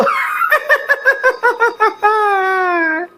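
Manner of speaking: long high villainous cackle; a wicked gloating laugh; evil cackle. Acted. Shakuni laughs in triumph.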